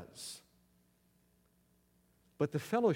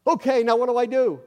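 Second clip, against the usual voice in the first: anxious loud question; 8 semitones higher, 10 dB louder than usual. Retold or imitated. Trapped man asking operator.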